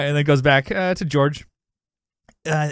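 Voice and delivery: usual speaking voice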